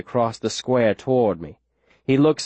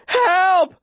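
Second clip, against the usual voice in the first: high desperate yell; shouting for help; 4 dB louder than usual. A narrator's reading. Boy carrying a wounded girl into town, desperate shout. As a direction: cry out high for anyone to hear.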